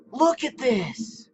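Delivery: high, slow, tender wonder; cooing in awe. Real, not acted. Marveling at an animal.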